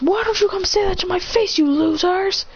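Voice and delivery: high, taunting dare; taunting challenge. Acted. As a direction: goad the crowd.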